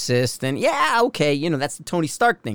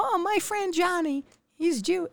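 very high, soft put-on voice; softly squeaked PSA imitation; mock TV PSA; 6 dB quieter than usual. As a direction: imitate an old public service ad in a tiny high voice. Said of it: "one of those P S As on T V".

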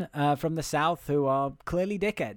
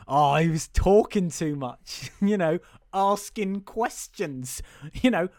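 put-on jolly young boy; 2 semitones higher than usual. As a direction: play a hammed-up cheerful lad, giggling between phrases. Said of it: "He seems very jolly about it".

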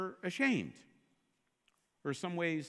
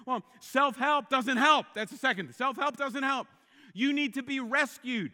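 louder, higher, emphatic; preaching at full force; 8 semitones higher, 8 dB louder than usual. Acted. Preacher at full force telling the congregation self-help fails. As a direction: drive it home from the pulpit.

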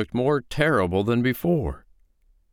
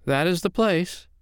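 high-pitched woman's voice; lilting storybook woman; unruffled foster mother; 10 semitones higher than usual. Acted. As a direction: point it out brightly, pitched up. Foster mother points out rocks.

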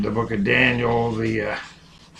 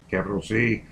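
dictating; 3 semitones lower, 6 dB quieter, faster than usual. Shown as a demonstration. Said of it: "dictating punctuation".

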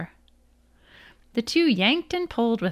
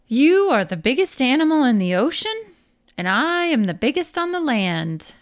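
loud, high, grand declaration; proud trumpeting; 6 semitones higher, 5 dB louder than usual. A narrator's reading. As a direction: trumpet it proudly, like a boastful elephant. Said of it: "Brer Elephant trumpeted proudly".

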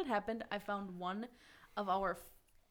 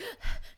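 sharp gasp; 13 semitones higher than usual. Acted. Waking up thirsty at night.

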